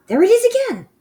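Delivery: high, screechy witch voice, sniffing eagerly; a lightly voiced witch hunting a scent. Witch insisting to the others, high and eager.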